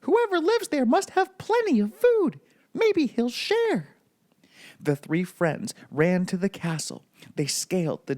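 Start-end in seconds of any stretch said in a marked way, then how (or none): 0.0-3.9 high, hopeful cartoon mouse voice, slowing at the end; Mickey voice. A narrator's reading. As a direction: keep the squeaky Mickey tone, hopeful. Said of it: "cried Mickey".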